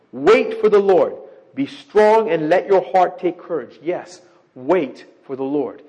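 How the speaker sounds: loud, slow scripture declaration; scripture reading. Acted. Preacher declaring the psalm's last verse to his congregation, slowly. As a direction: proclaim it, letting each word land.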